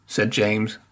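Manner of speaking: usual speaking voice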